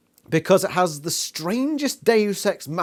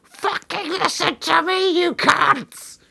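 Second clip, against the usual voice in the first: wailing, much louder, then high and slow; mock crying shout; 12 semitones higher, 7 dB louder, much slower than usual. Imitating Cassie wailing at the crowd, crying shout. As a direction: bawl at them like a tantrum. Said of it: "Cassie's there fucking".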